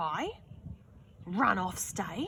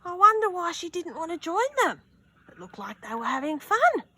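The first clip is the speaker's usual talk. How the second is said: curious high voice; 10 semitones higher, 5 dB louder, faster than usual. Acted. Reader comments aloud.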